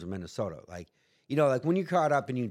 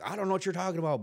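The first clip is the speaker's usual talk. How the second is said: rushed denial; 8 semitones higher, much faster than usual. Retold or imitated. Fast denial to agent.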